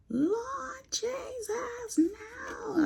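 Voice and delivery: high, breathy mock wail of grief; imitating wailing mourner. Mimicking a mourner at a funeral, high mock wail. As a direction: cry it out like a mourner at the casket. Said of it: "they scream and cry".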